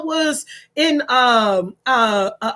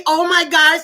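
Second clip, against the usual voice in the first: ordinary talk, nothing marked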